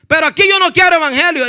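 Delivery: fast, high, loud retold rejection; angry refusal. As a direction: act out slamming the door on a preacher. Hostile man rejects the preacher. (rechazo enojado y rápido, imitado)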